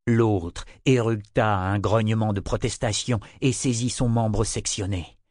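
ordinary delivery, nothing marked

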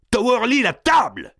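high, urgent shout; shouted order. A narrator's reading. Gournay needs help holding the king, shouted order. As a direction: yell for the giant to act. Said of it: "cria Gournay".

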